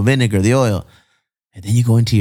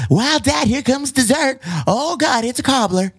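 put-on much higher, bratty little girl voice; Violet from Willy Wonka; 13 semitones higher than usual. Host imitates Violet from Willy Wonka gushing over dessert. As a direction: squeal it like a spoiled little girl. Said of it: "I feel like the little girl from the Willy Wonka".

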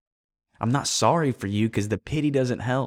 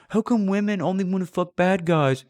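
higher, mock-whining complaints; mock whiny self-pity, pitched up; whiny inner voice; 6 semitones higher than usual. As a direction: play the little complainer in your head. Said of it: "that's saying".

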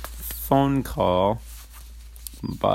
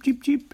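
high chick peeps; chick cheeping. Retold or imitated. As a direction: squeak it like a baby chick. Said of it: "they go up and they cheep".

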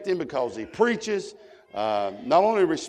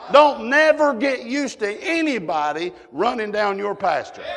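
raised, louder preaching voice with punched words; forceful preaching; 5 semitones higher, 5 dB louder than usual. Real, not acted. Preacher warning the church about running down their pastor, forceful preaching. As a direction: drive the point home to the congregation.

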